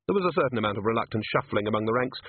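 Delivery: ordinary delivery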